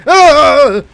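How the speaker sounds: high, loud cries of no; real frustrated wail; despairing shout. Real, not acted. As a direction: yell it as the run slips away. Losing the run in game.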